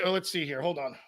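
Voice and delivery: ordinary talk, nothing marked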